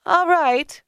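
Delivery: high-pitched agreement; high, emphatic character reply; grudging woman. A narrator's reading. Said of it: "replied Leah".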